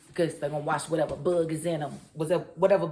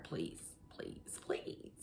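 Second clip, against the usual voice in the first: whispered begging, much softer; desperate whispered please; pleading whisper; 7 semitones higher, 18 dB quieter, much slower than usual. Real, not acted. Begging the writers to stop.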